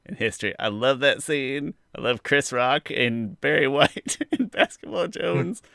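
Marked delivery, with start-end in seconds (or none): none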